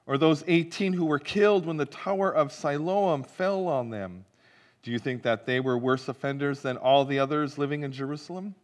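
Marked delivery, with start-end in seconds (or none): none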